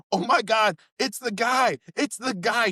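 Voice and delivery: high, loud imitation of an excited girl; excited recognition. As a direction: squeal it like spotting someone infamous. Girl excitedly recognizes the snorting guy.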